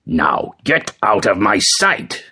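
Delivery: slow, angry order without shouting; an understated roar, drawn out. A narrator's reading. Father sending his beaten son away, restrained angry roar. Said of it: "roared his father".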